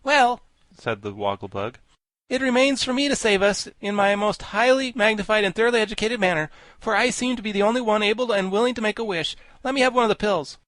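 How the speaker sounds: high, loud character voice; high-pitched self-important insect; Wogglebug, pompous volunteer. A narrator's reading. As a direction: pompously offer to save everyone.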